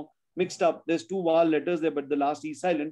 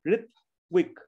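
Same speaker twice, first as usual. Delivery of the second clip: RITWIK in two pieces: Rit, wik; split into syllables. Shown as a demonstration. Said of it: "Two times, that's two syllables".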